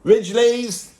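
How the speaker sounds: mock rallying call, building to a loud shout; playful shout into the crowd. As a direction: summon the Ridgeleys at the top of your voice. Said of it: "I'm gonna go and find one".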